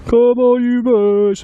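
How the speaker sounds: loud terrace cheer. Acted. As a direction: shout it to the players on the pitch. Football chants we can shout.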